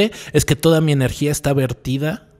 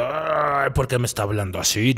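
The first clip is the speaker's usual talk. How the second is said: mock inner grumble; 3 semitones lower, 6 dB quieter than usual. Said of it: "El de".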